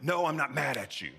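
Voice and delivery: mock-angry outburst; mad tone. Shown as a demonstration. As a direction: snap it angrily, contradicting the words. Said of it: "your words do not match your tone".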